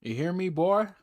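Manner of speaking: loud, high mock officer threat; cliche officer impression. Mocking the caller's story as a police officer, hammed-up. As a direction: ham up a menacing cop, loud and high. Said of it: "like the most cliche Django ass".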